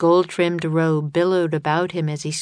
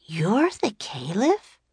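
higher, slow astonished question; amazed girl voice; 5 semitones higher than usual. A narrator's reading. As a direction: voice Annie as stunned. Annie amazed.

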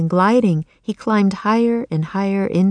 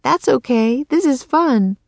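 croaked; 6 semitones higher than usual. A narrator's reading. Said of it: "she croaked".

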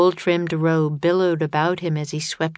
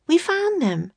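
put-on high young girl; 13 semitones higher than usual. A narrator's reading. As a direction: voice Annie as a girl. Annie voiced by narrator.

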